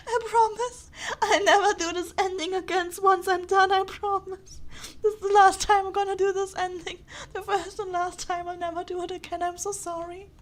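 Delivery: loud, upset vow easing into apology; tearful promise. Real, not acted. Player promising never to repeat the ending, tearful. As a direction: swear it through tears. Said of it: "I'm about to cry".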